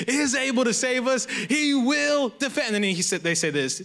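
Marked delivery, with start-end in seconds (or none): 0.1-2.7 raised, fired-up Bible reading; going off. Retold or imitated. Preacher reading the defiant reply, fired up and high. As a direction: read it like they're really going after the king. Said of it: "Ooh, they're getting it".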